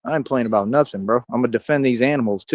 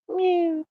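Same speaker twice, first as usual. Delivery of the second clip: high, soft meow at the cat; a cat's meow, much higher; imitated meow; 5 dB quieter than usual. Real, not acted. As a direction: mew back to the kitty. Meowing at his cat.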